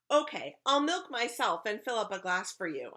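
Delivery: put-on lively storybook child's pitch; eager offer. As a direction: offer it brightly to win them over. Eager offer to older kids.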